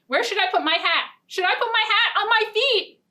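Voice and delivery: high, playful mock-puzzled asking; silly question. Storyteller teasing the children about where the hat goes, high and playful. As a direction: tease the kids with a silly idea.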